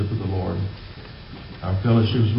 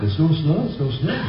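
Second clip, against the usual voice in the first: mock excitement, pitched up; high, giddy mock news report; excited newsmen; 6 semitones higher than usual. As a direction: imitate worked-up newsmen, rising at each end. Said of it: "the newsmen got all excited".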